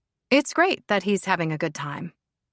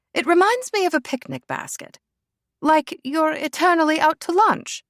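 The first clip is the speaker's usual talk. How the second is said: higher, louder posh aristocrat, delighted; posh lady; 10 semitones higher, 4 dB louder than usual. Acted. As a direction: savour it like a treat. Lady Helena muses posh.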